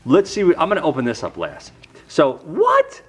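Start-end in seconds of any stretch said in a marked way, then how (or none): 2.1-3.0 much higher, excited squeals of disbelief; can't believe the gift, voice shooting up; thrilled disbelief. Real, not acted. Vlogger opening Marcus's package, thrilled disbelief.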